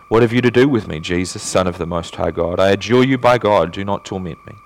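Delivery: ordinary delivery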